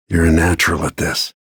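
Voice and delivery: usual speaking voice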